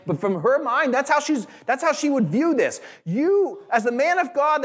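high, driving sermon voice; preaching at full force. Acted. Pastor explaining her grief to the congregation, impassioned. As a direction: press the point home to the church.

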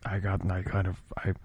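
breaking into giggles mid-whisper; whisper, about to crack up. Real, not acted. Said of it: "Please stop whispering into the mic".

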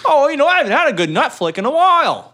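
high, loud put-on customer; mock customer voice. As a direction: play a cheeky customer.

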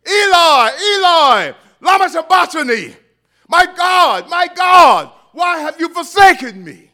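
high, loud anguished cry; cried out in anguish. Acted. Jesus on the cross crying out in anguish. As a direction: cry it out in agony to God. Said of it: "Jesus cried out in anguish".

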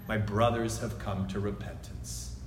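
almost breathe the words with awe; tender, quiet realization; hushed revelation. Acted. Repeated hushed.

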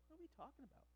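soft, very high bewildered question; acted-out confusion, small high voice; baffled. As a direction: squeak it out, totally lost. Acting out his baffled reply.

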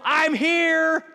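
loud, high sing-out arrival call; Mary. Acted. Mary announcing herself to Elizabeth, calling out. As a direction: call it through the whole house. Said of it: "She hears Mary go".

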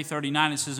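usual speaking voice

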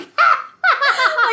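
squealing laugh; high burst of laughter. Real, not acted. Mom laughing while retelling the milk fiasco. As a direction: break into a high giggle.

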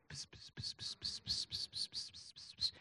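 unvoiced pss pss whispering into an ear; soft secretive whisper sounds; whispered dictation hiss. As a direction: mimic God whispering dictation. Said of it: "God was in heaven going".

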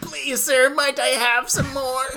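high pleading urchin voice; orphan boy voice. Pretend. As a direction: beg sweetly like Oliver Twist. Oliver Twist quote after remark.

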